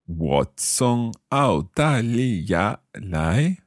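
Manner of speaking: 我从澳大利亚来 dragged out slowly, word by word; slow learner repeat. Real, not acted. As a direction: repeat it carefully like a beginner.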